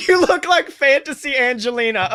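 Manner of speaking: high laughing through the line. Real, not acted. GM laughs at disguise.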